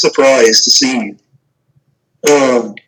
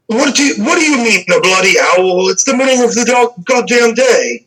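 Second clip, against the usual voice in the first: captain's call; 7 semitones higher than usual. Acted. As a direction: scoff at the silly owl story from around the corner. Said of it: "shout out to the man".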